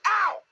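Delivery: high yelp on landing. A narrator's reading. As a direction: yelp as you bump down. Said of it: "as he came down".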